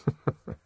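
brief quiet chuckle; acted low-volume chuckle; soft laugh. A narrator's reading. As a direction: laugh it off softly. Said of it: "Helmholtz only laughed".

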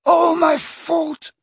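high, slow, sobbing confession. A narrator's reading. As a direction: break down over the words. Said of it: "he sobbed".

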